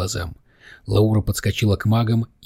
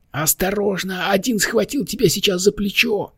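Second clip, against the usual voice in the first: very high little monster voice; hammed-up squeal of warning; mock-terrified wail; 11 semitones higher than usual. A narrator's reading. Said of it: "завопил монстрик, прижав лапки к щекам в показном ужасе".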